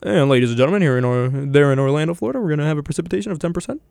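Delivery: playful weather report, picking up speed; put-on forecaster patter; mock weather forecaster. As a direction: rattle off the forecast in presenter cadence.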